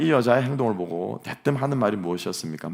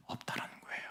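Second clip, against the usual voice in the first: whispered; 17 dB quieter than usual. Acted.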